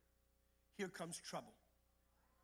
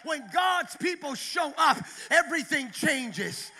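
the repeat belted out, GOD'S punched; louder preached repeat; 3 semitones higher, 9 dB louder, slower than usual. Shown as a demonstration. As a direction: hit it harder the second time. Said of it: "Let me repeat that".

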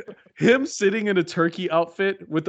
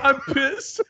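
high-pitched mock turkey one-liner; 10 semitones higher than usual. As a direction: imitate the killer turkey, pitched up. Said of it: "And he goes".

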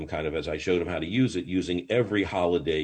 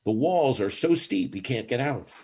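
ordinary talk

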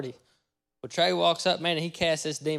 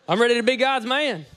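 mock eager boast; 8 semitones higher, 8 dB louder than usual. Joking boast in his dream, laughing.

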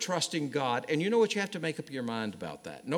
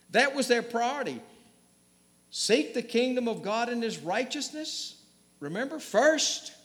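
much higher, urgent sermon voice; fervent preaching; 4 semitones higher than usual. Acted. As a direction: press the point home, high and intense. Preacher at full force.

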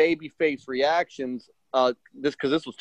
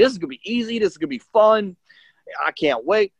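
excited; 7 semitones higher than usual. Retold or imitated. Hyped before the big match.

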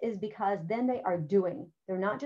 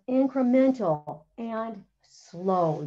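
incremental and slow powoli, z naciskiem na każde słowo; slow stressed key words; 4 dB louder, much slower than usual. Shown as a demonstration. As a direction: wbij uczniom do głowy incremental i slow. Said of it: "two key words for teaching dialogue".

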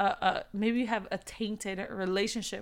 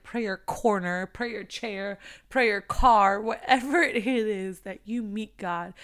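ordinary talk, nothing marked

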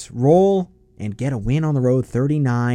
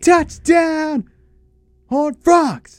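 high, shouted touchdown call; announcer shout; 4 dB louder, much slower than usual. Retold or imitated. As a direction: belt it out like an over-the-top sportscaster. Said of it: "can't even imitate how he's".

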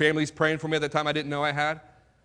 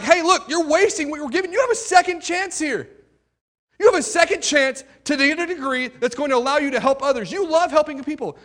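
much higher, urgent mentor voice; mentor calling him out; 9 semitones higher, 3 dB louder than usual. Retold or imitated. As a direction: push him like a caring coach.

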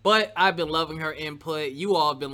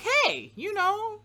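much higher, squeaky imitation of a friend's voice; mock high voice of Jessica; 5 dB louder than usual. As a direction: mimic her in a cartoonishly high voice. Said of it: "You don't sound like that".

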